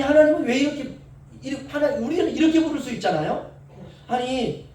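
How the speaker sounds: usual speaking voice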